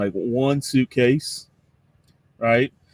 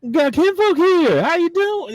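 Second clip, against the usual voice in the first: put-on much higher and louder in a Cajun accent, delighted; excited Cajun greeting; 12 semitones higher, 7 dB louder, slower than usual. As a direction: whoop it out like meeting long-lost kin, Cajun style. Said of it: "thick Cajun".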